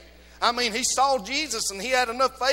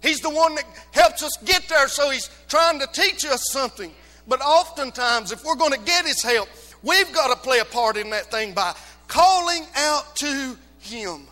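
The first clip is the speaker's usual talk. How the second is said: 1 full-force preaching; 6 semitones higher, 8 dB louder than usual. Acted.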